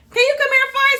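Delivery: loud high call downstairs; yelling across the room. Real, not acted. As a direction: shout it down the stairs. Calling husband from upstairs.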